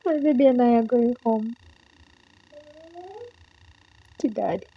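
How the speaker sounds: high, trembling happy crying; tearful joy. Real, not acted. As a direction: speak through happy tears. Overjoyed, crying at visa news.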